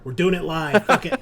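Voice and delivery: loud, rising to a high yell on the last words; real reckless outburst; defiant shout. Real, not acted. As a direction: throw caution to the wind, loudly. Host gives up on sound checks.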